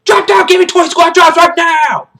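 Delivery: high, screamed drill-sergeant rage; furious outburst. Acted. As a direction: explode into slow full-volume shouting. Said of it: "He's like".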